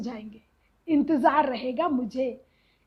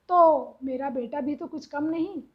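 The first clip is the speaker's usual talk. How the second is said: usual speaking voice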